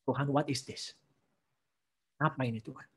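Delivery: quietly confused; hushed bewildered prayer. Pretend. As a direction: murmur it to God. Imagined listener questioning God.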